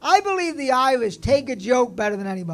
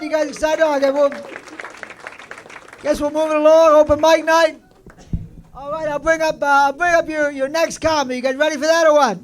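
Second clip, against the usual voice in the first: ordinary delivery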